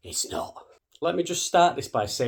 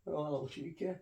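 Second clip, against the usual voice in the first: soft coo to a pet; talking to cat; 4 semitones higher, 10 dB quieter than usual. Real, not acted. Greeting his cat.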